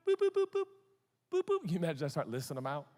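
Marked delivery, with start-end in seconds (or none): 0.1-1.6 high quick boop sounds; playful boops. Real, not acted. Preacher listing people to forgive, high playful boops. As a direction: tick them off with little boops.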